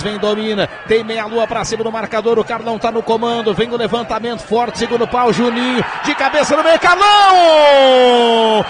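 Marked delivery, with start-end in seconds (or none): 6.0-8.6 explosive goal commentary. Real, not acted. Goal call.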